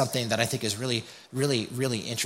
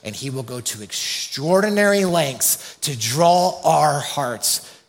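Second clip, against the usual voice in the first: slow, weighty preaching with long pauses; emphatic preaching; slower than usual. Acted. Pastor to congregation on God's relentless love, slow emphatic preaching. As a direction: lean on every word like a pastor driving it home.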